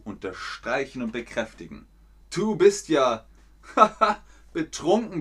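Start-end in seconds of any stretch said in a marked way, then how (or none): 2.3-5.2 laughing through the example; giggling while saying it. Shown as a demonstration. Teacher laughing through a drunk example.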